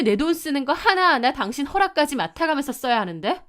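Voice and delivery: finally boiling over at him; losing patience. Acted. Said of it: "더 이상은 참을 수가 없더라고요".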